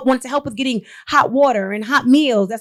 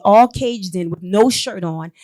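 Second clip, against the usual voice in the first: usual speaking voice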